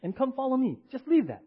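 a preacher voicing Jesus, insistent and loud; voicing Jesus pleading. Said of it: "And Jesus".

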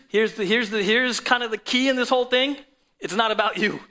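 preaching at full force. Acted. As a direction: build the sermon to a booming peak.

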